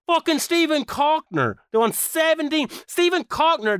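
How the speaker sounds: high-pitched incredulous outrage; outraged rant. Real, not acted. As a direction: squeal the name in disbelief. Outraged rant at asking price.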